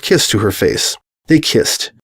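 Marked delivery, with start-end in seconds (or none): none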